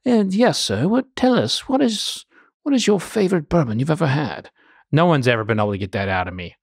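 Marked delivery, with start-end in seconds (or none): 0.0-4.5 imitating a posh British interviewer, pitched up; mock British host asking politely; British accent. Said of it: "in his British accent".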